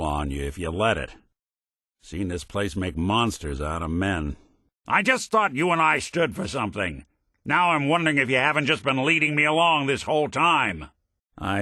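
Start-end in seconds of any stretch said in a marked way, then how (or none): 4.9-10.9 raised-pitch, indignant accusation; betrayed anger. Acted. Detective who feels led along by his partner, angry. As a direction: confront them, hurt and angry.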